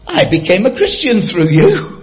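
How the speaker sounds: loud, high, delighted exclamation; excited gushing. Retold or imitated. Policeman telling Billy Graham of his conversion, excited. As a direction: burst out with it, laughter close behind.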